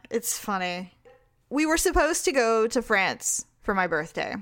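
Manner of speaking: ordinary talk, nothing marked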